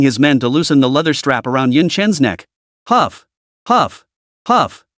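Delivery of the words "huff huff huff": ragged high gasps; gasping for air. Acted. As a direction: pant hard after choking. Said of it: "Yun Chen gasped for air".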